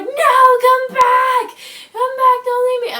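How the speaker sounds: high, loud pleading shout after him; retold desperate yell. Girl under the ATV yells at the driver, high desperate shout. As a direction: scream at him to come back. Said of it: "I remember yelling".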